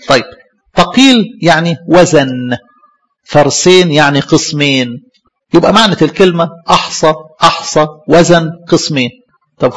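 ordinary talk

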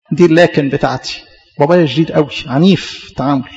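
normal talking voice

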